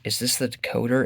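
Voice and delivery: ordinary delivery, nothing marked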